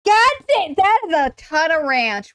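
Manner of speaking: high, loud stunned outburst; amazed disgust. Real, not acted. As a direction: blurt it out stunned. Reacting to a gross gif.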